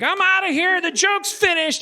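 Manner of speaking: high-pitched acted proclamation; defiant exit. Seal quitting the joke on the worker, defiant. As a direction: declare it way up high, drawing out the end.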